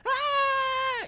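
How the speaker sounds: very high, loud excited squeal; imitated child's scream. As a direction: shriek like an overjoyed small boy. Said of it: "You're so excited".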